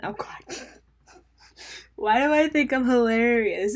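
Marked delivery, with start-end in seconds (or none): 0.6-3.8 laughing. Real, not acted. Laughing at own joke.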